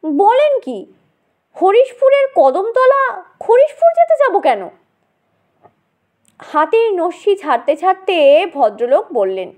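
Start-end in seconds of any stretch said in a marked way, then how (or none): 0.0-4.6 surprised. Acted. Boys surprised by advice.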